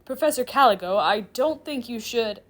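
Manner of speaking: loud, slowing protest; trying to interject. Acted. Chair demon trying to interject, protesting. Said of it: "Sullivan tries to interject".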